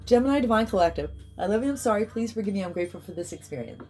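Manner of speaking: earnest repeated prayer, softening; Ho'oponopono prayer. Real, not acted. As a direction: pray it over and over, sincere. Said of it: "Ho'oponopono prayer".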